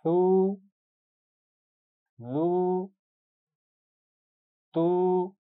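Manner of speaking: फू, लू, तू each held long and clear; stretched long u. Shown as a demonstration. Teacher models long vowels for the class: held u sounds. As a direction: draw every u out evenly, no whistle. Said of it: "सीटी नहीं होनी चाहिए इस आवाज में".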